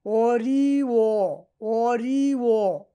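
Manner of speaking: অরিও very slow and loud, "ooo-riii-wo"; teacher sounding out অরিও emphatically; demonstrated pronunciation. Shown as a demonstration. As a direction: stretch each vowel of অরিও for learners. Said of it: "এই বটাকে আমরা উ বলবো".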